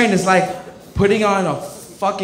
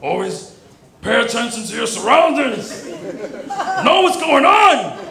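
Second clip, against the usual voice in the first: dad's advice; 4 semitones higher than usual. Retold or imitated. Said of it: "my dad was like".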